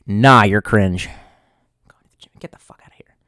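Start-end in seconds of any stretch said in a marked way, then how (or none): 1.9-3.2 whisper. Real, not acted. Mocking a chatter's comment.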